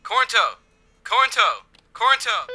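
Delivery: loud, rising calls of a name; calling out. Acted. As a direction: yell the name to reach him. Calling out over walkie-talkie.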